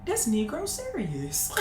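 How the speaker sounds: soft, slow disbelief; disbelieving mutter. Retold or imitated. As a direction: say it under your breath in shock. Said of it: "I looked at her like".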